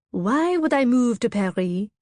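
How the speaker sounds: a light, hammed-up French accent; teasing her friend in a mock French accent. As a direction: ask it like a playful Parisian, French accent lightly put on. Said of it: "I ask in my best French accent".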